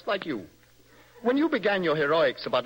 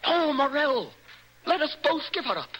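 excited plea; 8 semitones higher than usual. Acted.

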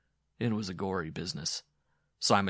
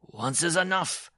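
slow, raised, forceful verdict; grim; 10 semitones higher, 5 dB louder than usual. A narrator's reading. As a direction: cut her off grimly, drawn out. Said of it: "the dwarf said grimly".

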